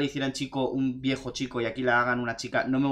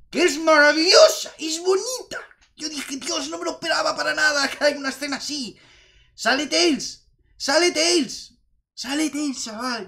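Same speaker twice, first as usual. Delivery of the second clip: much higher, gushing fan voice; excited; 12 semitones higher, 3 dB louder than usual. Real, not acted. Fan telling viewers about the post-credits scene, thrilled. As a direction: squeal with delight about the scene.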